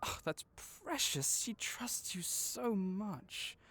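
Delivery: high, slow and tender; tender awe. Acted. Vet cooing over the trusting creature, high and slow. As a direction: melt at the sight of the trusting creature.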